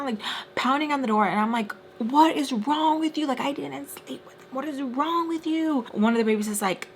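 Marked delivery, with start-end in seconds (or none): 2.0-5.8 retold exasperated protest. Teller answering friend pounding on door, high-pitched exasperation.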